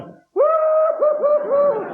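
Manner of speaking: very high party whoops; drunken whooping. Retold or imitated. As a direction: whoop like a wasted reveller. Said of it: "you never see women going".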